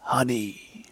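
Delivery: low, breathy whisper; hushed, secretive whisper; deep whisper. A narrator's reading. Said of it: "said in a deep whisper".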